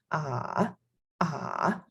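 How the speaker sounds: "Ah" dropped low, then bounced back up; soft dipping "Ah" that comes back up; dip and bounce back. Shown as a demonstration. Teacher shows full third tone, dipping "Ah". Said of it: "and then bounce back".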